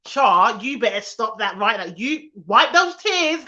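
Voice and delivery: imitated Essex mum scolding loudly; mum's telling-off. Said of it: "I can hear her voice".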